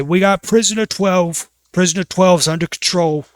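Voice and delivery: a guard calling it in. Acted. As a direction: bark it like a prison guard. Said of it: "and they say".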